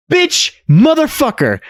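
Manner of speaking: high yelled mock insults; hammed-up outrage yell; mock outburst. Gamer mockingly yelling as the angry father, very high.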